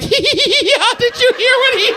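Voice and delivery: imitated high-pitched laughing fit; helpless laughing. Server who can't handle the kid's remark, re-enacted helpless laughter. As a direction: squeal the words through uncontrollable giggles. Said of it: "I've had this laugh my whole life".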